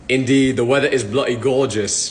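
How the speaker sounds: fake British accent. Copying the British stranger's accent back in a fake British accent. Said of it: "talk in that exact same accent back to them".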